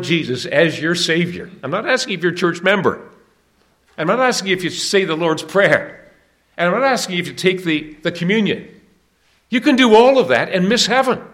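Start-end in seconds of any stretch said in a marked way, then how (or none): 1.6-11.2 rising from firm to a loud, high cry; insistent sermon climax, up to a shout; building preacher's cry. Real, not acted. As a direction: build each line until the last one rings out.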